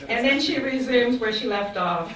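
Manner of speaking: speaking through real laughter; real warm laughter, then speech still laughing. Real, not acted. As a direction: laugh fondly while carrying on.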